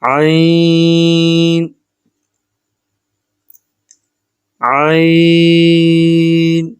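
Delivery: عین held very long from the throat; long recited letter. Shown as a demonstration. Teacher reciting the letter for students, long and held. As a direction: stretch عین out slowly, throat pressed. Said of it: "عین اور غین کی ادائیگی کا طریقہ".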